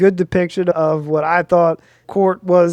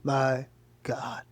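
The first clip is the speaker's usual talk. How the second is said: slow, breathless whispered disbelief; retold exasperated whisper to himself; 6 semitones lower, 7 dB quieter, much slower than usual. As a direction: whisper it like you can't believe your mom. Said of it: "And I'm like".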